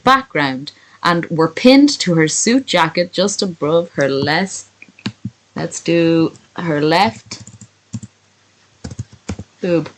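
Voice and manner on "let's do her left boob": slow, stretched words while composing; unhurried, dragging out the words; drawn-out thinking aloud. Real, not acted. Composing story text aloud word by word.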